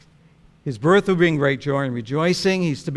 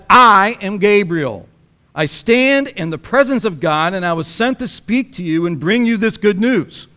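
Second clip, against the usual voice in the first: commanding angel Gabriel; 4 semitones higher, 7 dB louder than usual. Retold or imitated. Preacher voicing Gabriel rebuking Zechariah, booming. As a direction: announce who you are with full authority. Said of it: "I think that's how he says it".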